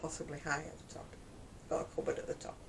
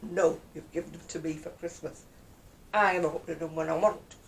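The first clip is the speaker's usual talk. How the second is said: mock defiance; 5 dB louder than usual. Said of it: "I'd be like".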